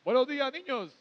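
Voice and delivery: high, sing-song teacher greeting; kindergarten teacher voice. Acted. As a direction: greet the class like a strict schoolteacher. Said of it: "una voz de profesora de escuela".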